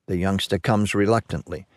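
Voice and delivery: ordinary delivery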